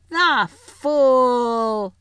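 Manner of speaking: high, loud triumphant cry; witch's cry. A narrator's reading. Witch crying out over the captured lion. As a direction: crow it out in cruel triumph. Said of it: "she cried".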